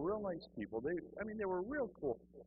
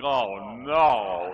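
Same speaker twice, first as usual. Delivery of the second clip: low, loud, drawn-out dismay; mock dread; 4 semitones lower, 14 dB louder than usual. Hearing they will speak in tongues, loud low dread. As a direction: groan it low and big in comic horror.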